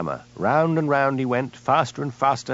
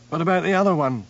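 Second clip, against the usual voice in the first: out of breath; 7 semitones higher than usual. A narrator's reading. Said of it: "panted Hamish".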